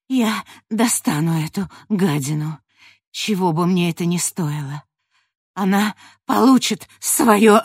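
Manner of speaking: rising to an angry vow; vengeful vow. Acted. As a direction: grit out a promise of revenge. Coughing warrior vows revenge.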